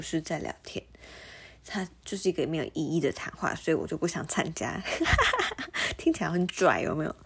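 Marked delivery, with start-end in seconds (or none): 4.9-5.9 high laugh. Real, not acted. Laughs at own claim.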